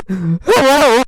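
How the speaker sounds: high, loud nervous hello; high loud frightened girl voice; shaky. Acted. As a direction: squeak it out, scared. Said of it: "Po said shakily".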